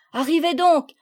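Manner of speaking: called out loud to the others; calling out. A narrator's reading. Children calling to their friends, loud. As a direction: shout the welcome across the room. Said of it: "leur crièrent-ils".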